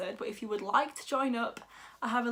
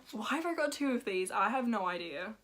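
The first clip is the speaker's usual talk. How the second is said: ordinary talk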